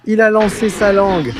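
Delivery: ordinary delivery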